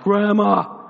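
loud, high pleading call; boy crying out to grandma from the back seat. Retold or imitated. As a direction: yell for grandma to rescue you. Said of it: "I just cry out".

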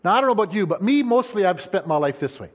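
usual speaking voice

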